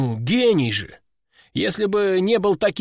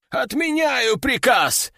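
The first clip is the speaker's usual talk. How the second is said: high, slow yelled cancel; 8 semitones higher, slower than usual. Acted. Master panicking at the demon, a high slow yell. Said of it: "завопил мессир".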